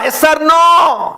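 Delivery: each word stretched slow and loud; drawn-out demand. Real, not acted. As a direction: hold every word, demand an answer. Preacher presses the congregation for an answer. (నెమ్మదిగా సాగదీసి గట్టిగా)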